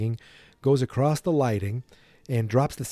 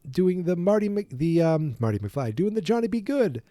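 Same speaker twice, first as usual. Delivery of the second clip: high, excited retelling voice; a hint of a panicky kid; frantic Marty; 8 semitones higher than usual. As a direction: pitch up like a flustered teen explaining. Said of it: "He goes".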